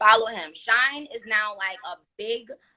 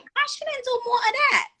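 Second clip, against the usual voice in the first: incredulous high squeal. Retold or imitated. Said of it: "I was like".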